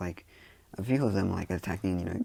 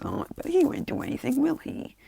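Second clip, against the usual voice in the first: very high, coy falsetto; mock-innocent taunt. Izuku walking up to the villains, high teasing falsetto. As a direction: tease in a high, sweet put-on voice.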